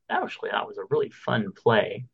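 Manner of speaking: ordinary talk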